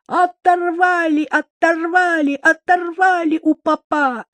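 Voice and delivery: high-pitched, drawn-out yell; frantic yell. Acted. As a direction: wail it out at the top of your voice. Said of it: "истошно завопил".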